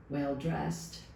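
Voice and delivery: plain reading voice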